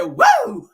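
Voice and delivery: very high, loud whoop; Ric Flair. Retold or imitated. Imitating a wrestler slamming rivals in the ring, triumphant whoop. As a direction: shriek out a wrestler's triumphant woo. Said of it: "He said".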